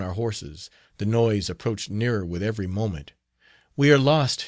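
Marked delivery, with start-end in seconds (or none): 3.8-4.4 higher and louder cry of despair; a desperate outcry in the chase; frightened exclaim. A narrator's reading. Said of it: "she exclaimed".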